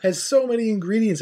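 laughing through the words, pitch up; giggling while trying to explain; half-laughing explanation. Real, not acted. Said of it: "he's laughing when I say pho".

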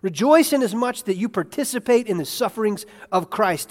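normal talking voice

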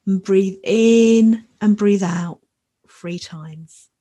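calm and unhurried, long pauses; guided meditation. Acted. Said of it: "So now I'd like to lead us in a short new moon meditation".

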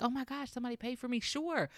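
high, rushed, pleasantly surprised customer; imagined delight. Acted. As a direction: act out thrilled disbelief, quick and high-pitched. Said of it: "You feel so great".

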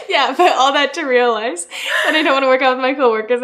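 laughing through a retold line; giggly fast retelling; laughing quote. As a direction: quote her while cracking up. Quoting friend while laughing.

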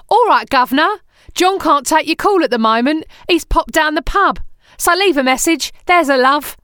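hammed-up Cockney, chirpy and cheeky; mock Cockney answerphone greeting; Cockney accent. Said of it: "In a Cockney accent".

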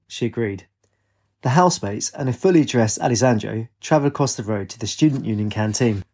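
ordinary delivery

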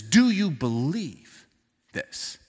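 slow, earnest asking; gentle sincere question. Retold or imitated. Pastor voicing Jesus asking Martha, slow and sincere. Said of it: "Jesus says".

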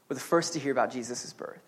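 ordinary talk

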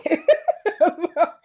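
high, loud burst of laughter; laughing. Real, not acted. Storyteller cracking up over obeying mama, laughing. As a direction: laugh hard at your own story. Laughs at her own retold line.

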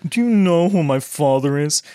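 put-on snooty spoiled prep boy; lightly posh entitled brat; posh pretentious rich kid. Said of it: "your posh, pretentious".

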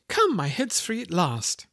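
put-on like a child freed at last; delighted. A narrator's reading. Alice rejoices her head is free, slow and delighted. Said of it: "in a tone of delight".

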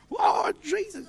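new believer overwhelmed with joy; joyful exclamation. Retold or imitated. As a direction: burst out with joy. Newly born-again person exclaiming.

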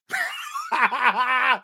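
gleeful villain laugh; evil laughing. Real, not acted. Picturing the right wing mad, evil laugh. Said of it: "after all that evil laughing".

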